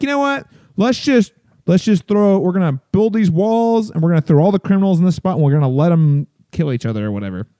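high, breezy careless official; mock official voice. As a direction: toss off the plan like a reckless mayor. City officials voiced, mock plan.

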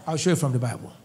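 normal talking voice

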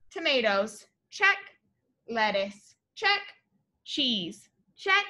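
lively, sing-song storybook read-aloud; bright read-aloud for little kids; reading to children. A narrator's reading. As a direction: read it like a picture book to a class.

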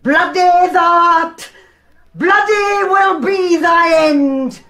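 slow shouted curse. Acted. Mother's final curse on her son, slow and shouted. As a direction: hurl each word slowly like a doom.

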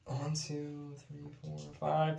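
counting dice under the breath, softly; whispered counting. Real, not acted. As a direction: murmur the numbers to yourself.

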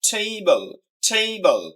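Table as a long TAY falling to bol; first-syllable stress. Shown as a demonstration. Teacher repeating the stressed word, emphatic. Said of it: "প্রথম সিলেবলটাকে stress দেওয়া হয়েছে".

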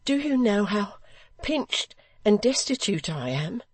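high, anguished widow; distressed retort. A narrator's reading. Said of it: "she retorted".

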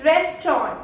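"रेस्टोरेंट" as two syllables, res-tron; correct pronunciation. Shown as a demonstration. Teacher answering her own question, correct pronunciation. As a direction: drop the "rent" in "रेस्टोरेंट", say tron. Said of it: "क्या बोलते हैं".

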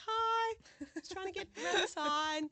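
high, loud, sheepish hi; awkward high hi. Retold or imitated. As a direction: squeak a startled hello. Awkward greeting to a neighbour.

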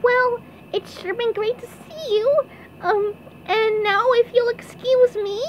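high falsetto excuse; SpongeBob as princess. A narrator's reading. SpongeBob trying to leave the crowd, high-voiced excuse. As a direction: make excuses in a high put-on voice. SpongeBob tries to slip away.

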